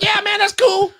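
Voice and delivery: high, loud, enthusiastic reassurance; eager friendly yes. Retold or imitated. Host reassuring the nervous young interviewer, high and loud. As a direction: throw it out big and friendly to put him at ease. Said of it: "I'm like".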